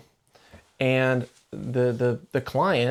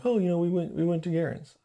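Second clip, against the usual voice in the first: lightly imitated kid, higher and softer; soft, higher mock-innocent answer; evasive son; 5 semitones higher, 5 dB quieter than usual. Son answering dad about downtown, lightly imitated evasiveness.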